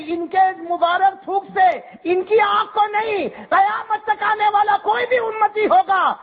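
a priest crying out a prophecy to the crowd; full-force preaching. Acted. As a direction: let the prophecy ring out.

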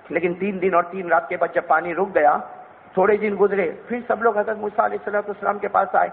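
usual speaking voice

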